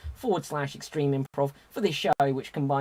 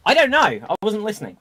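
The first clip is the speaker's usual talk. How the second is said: sassy; 5 semitones higher, 5 dB louder, faster than usual. Real, not acted. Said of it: "Little sass there, [name]".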